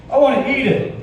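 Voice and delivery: put-on a goofy kid blurting it out; kid's voice. Kid voiced in classroom story.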